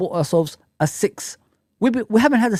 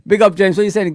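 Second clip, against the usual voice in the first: ordinary talk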